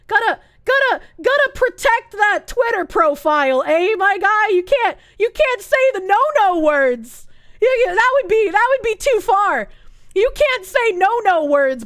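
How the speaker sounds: high, loud sarcastic mockery; mocking the poster for dodging a swear word. Mocking the poster's self-censorship.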